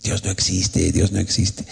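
low, hushed evil-spirit voice; soft, low insinuation; unclean spirits. Retold or imitated. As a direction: imitate spirits murmuring doubt in her ear. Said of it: "Y los espíritus inmundos".

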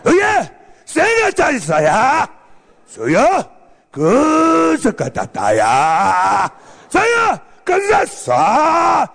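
drawn-out mock-Japanese barking; movie ninja. Ninja talks to his wife, stretched mock-Japanese shouts. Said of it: "el ninja le habla así".

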